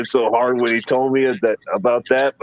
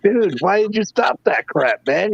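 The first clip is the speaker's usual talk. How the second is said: mock scolding; 8 semitones higher than usual. Retelling scolding his friend.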